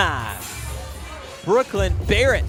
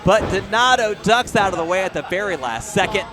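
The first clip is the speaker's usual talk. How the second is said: excited ringside call; wrestling commentator; 5 dB louder than usual. Real, not acted. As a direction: call each big move loud. Live wrestling play-by-play, big spots.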